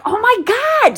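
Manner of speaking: high, squealed disbelief; a shocked, sky-high "oh my God"; exasperated oh my god. Retold or imitated. Retelling the rude line-cutter, high shocked cry. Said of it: "I'm like".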